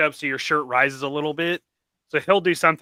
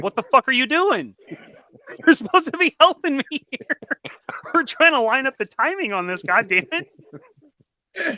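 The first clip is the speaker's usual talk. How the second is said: exasperated yell; 10 semitones higher than usual. Retold or imitated. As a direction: call out to a helper across the garage. Yelling at absent helper.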